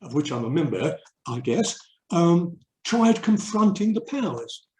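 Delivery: normal talking voice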